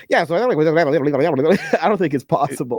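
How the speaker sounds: rapid-fire garbled babble; fast mock gibberish; sped-up playback impression. Shown as a demonstration. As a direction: gabble it like audio played at four times speed. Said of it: "Imagine four time".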